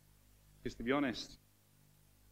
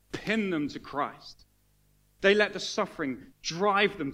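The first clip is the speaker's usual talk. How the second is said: loud, rising preacher's cry, slow on the key lines; fervent pulpit build; preaching at full force; 5 semitones higher, 6 dB louder, slower than usual. Acted. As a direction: drive it home to the congregation.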